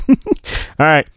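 ordinary delivery, nothing marked

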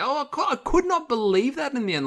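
high-pitched disbelief, words pushed up high; incredulous. Real, not acted. Podcast host ranting to his co-host in high-pitched disbelief. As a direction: sound like you truly can't believe what you saw.

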